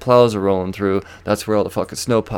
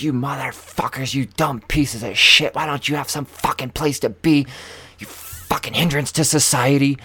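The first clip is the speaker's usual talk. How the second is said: higher, strained retold tirade; pissed-off ranting; 5 semitones higher than usual. Driver curses the old folks while digging out, retold angry rant. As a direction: rant the insults without full volume. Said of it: "I'm fucking pissed".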